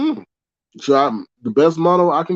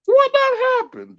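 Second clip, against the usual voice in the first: chopper voice. Pretend. Streamer asking chat about StreamYard, in a squeaky chopper voice. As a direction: drag it out in a high cartoon squeak. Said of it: "chopper voice".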